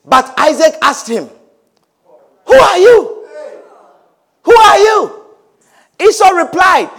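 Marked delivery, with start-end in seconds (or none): 2.5-5.2 put-on high, loud old man's cry of alarm; Isaac's shaken cry. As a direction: cry out like a startled blind father. Isaac voiced in retold story.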